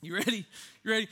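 normal talking voice

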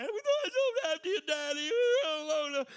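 high, loud mock wail of a scared kid; crying child. Kids crying out to daddy during hide and seek, high mock wail. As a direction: cry it like a small child lost in the game. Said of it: "they're crying".

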